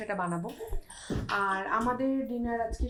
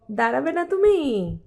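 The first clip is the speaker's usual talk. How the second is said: drawn-out coaxing; 3 semitones higher, 7 dB louder, slower than usual. Real, not acted. Mum presses toddler to stand, drawn-out coaxing. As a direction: draw out "তুমি" as a loud, coaxing question.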